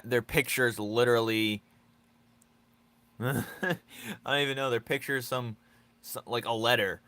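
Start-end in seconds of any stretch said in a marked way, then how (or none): none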